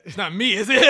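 loud laughing question. Real, not acted. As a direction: laugh through the words. Joke about the J.

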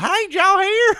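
high, loud mimicking voice; mocking imitation. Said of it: "you're like".

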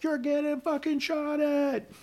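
high, drawn-out mock scream; retold scream. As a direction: imitate screaming a warning, without full volume. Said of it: "I start screaming at him".

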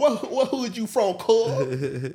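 high, loud mock challenge: where you from, cuz; imitating a gang challenge. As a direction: bark it like a hothead in the stands. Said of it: "He was like".

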